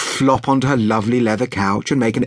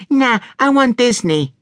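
put-on high-pitched little girl refusing; small child digging her heels in; high, stubborn child voice; very high girl's voice; 13 semitones higher than usual. A narrator's reading. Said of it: "in a very high voice".

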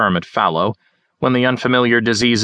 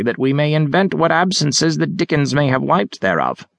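put-on higher, theatrical old gentleman; bow and declaim grandly; hammy Shakespearean actor; 4 semitones higher than usual. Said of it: "He had a broad accent".

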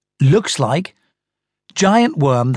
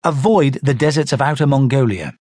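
ordinary delivery, nothing marked

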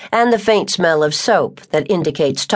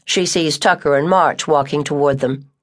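ordinary delivery

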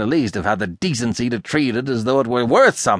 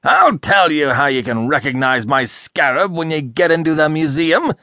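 high, loud, indignant harangue; furious tirade; 8 semitones higher, 5 dB louder than usual. A narrator's reading. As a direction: rant in a sudden burst of fury. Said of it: "burst of fury".

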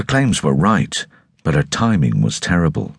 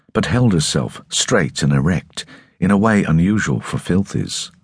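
ordinary delivery

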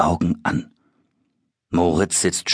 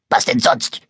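missmutig brummend; 5 semitones higher than usual. Acted. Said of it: "brummt das Monster missmutig".

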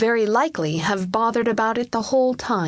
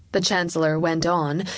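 ordinary delivery, nothing marked

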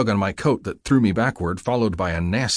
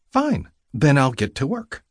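female nurse voice; 7 semitones higher than usual. Acted. Nurse voice in narrated scene.

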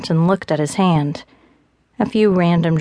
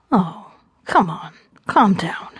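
lightly voiced calm man. Gabe soothes her, male voice.

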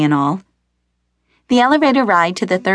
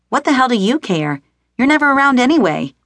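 higher, building resentful shout; booming angry son; 5 semitones higher than usual. A narrator's reading. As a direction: hurl it at his father. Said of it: "Damien's voice boomed down the hall".

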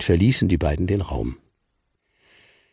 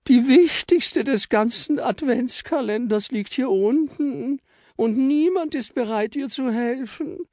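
very high, slow pleading little voice; squeaky Frau Stern. Acted. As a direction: plead sweetly in a tiny high voice. Frau Stern keeps lamenting.

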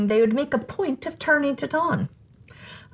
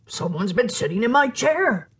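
ordinary delivery, nothing marked

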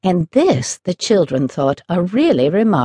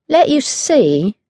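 higher, slow, hesitant words; slow, doubtful; 8 semitones higher, slower than usual. Acted. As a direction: weigh it up doubtfully. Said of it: "she said slowly".

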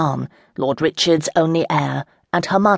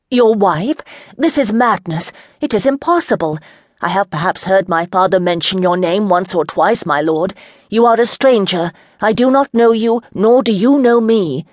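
higher, louder, indignant noblewoman; a lady scorning her captor, heated; defiant young lady; 4 semitones higher, 4 dB louder than usual. Acted. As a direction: throw the threat back at him. Chandra refuses the proposal.

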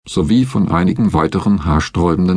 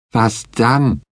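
defiant; 4 semitones higher than usual. A narrator's reading. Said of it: "fragte Betsy trotzig".

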